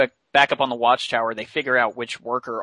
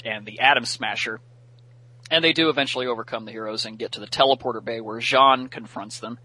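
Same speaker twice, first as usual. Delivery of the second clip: usual speaking voice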